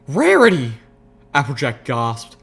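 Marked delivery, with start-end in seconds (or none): 0.1-0.7 loud, high shocked cry. Acted. Applejack horrified at Rarity, loud shocked cry. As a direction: cry out her name in alarm.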